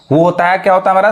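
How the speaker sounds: usual speaking voice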